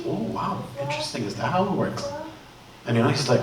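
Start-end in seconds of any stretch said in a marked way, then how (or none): 0.0-2.0 softly acted excitement, intrigued; excited alim. Alim hears Shaitan's story in a dream, quietly excited. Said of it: "gets really excited".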